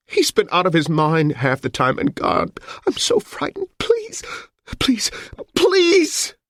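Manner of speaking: frightened, high pleading rising to a cry; voice breaking. Acted. Amelia begs the police, frightened, high and breaking. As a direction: beg in panic, voice cracking. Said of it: "and her voice broke".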